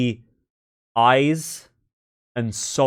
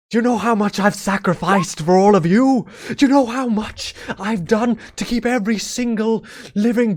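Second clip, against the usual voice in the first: much higher, strained, raging tirade; furious god's rant; 10 semitones higher than usual. Acted. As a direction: rage at the mortals who betrayed him. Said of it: "And he goes".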